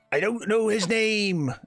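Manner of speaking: put-on high, slow, accented wizard. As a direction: try out a high voice for the wizard, drawn out. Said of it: "what accent did I".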